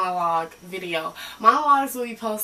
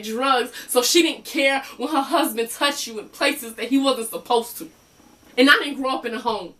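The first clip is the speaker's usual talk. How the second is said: higher, emphatic, wounded outpouring; acted bitter confession, pitch raised; broken patient venting; 4 semitones higher, 3 dB louder than usual. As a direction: vent old hurt at the therapist. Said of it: "had a chance to do a little venting".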